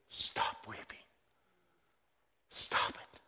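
whispered, soft stop weeping; hushed comfort. Retold or imitated. Elder soothing weeping John, whispered. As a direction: hush him gently like an elder.

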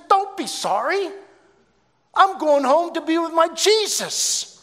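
very high, loud exultant cry; jubilant. Retold or imitated. Dying woman reassures pastor she is going home, jubilant. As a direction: burst out joyfully at the top of the voice. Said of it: "she had such joy".

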